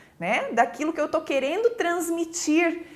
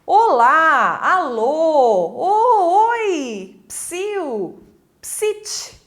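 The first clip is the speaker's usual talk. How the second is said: long, swooping hailing calls; calling out; 4 dB louder, much slower than usual. Shown as a demonstration. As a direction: call to someone, stretching each word, then soften to a psst. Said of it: "De chamamento".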